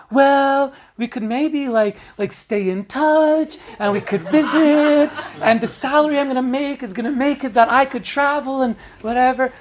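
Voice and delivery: smooth it over brightly; nervous upbeat excuses. Acted. Partner excusing herself in quarrel.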